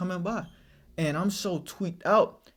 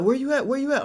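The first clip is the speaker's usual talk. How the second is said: friend on the phone again; 8 semitones higher, faster than usual. Retold or imitated. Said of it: "So he like".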